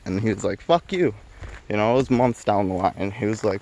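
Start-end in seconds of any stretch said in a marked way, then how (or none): 0.6-1.1 defiant retort. Retold or imitated. Friend defies cop.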